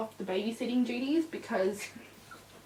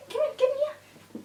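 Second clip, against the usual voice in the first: much higher sing-song puppy talk; coaxing puppy voice; 14 semitones higher than usual. Real, not acted. Owner coaxing puppy over, high puppy voice. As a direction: coax him over sweetly.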